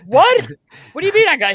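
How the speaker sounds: high, loud outburst of disbelief; incredulous. Retold or imitated. Player retelling his stunned reply to news of the job, incredulous. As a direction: blurt it out in retold shock.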